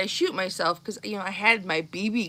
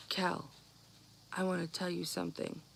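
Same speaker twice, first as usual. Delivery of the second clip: hushed serious; 3 semitones lower, 14 dB quieter than usual. Retold or imitated. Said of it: "He was dead serious".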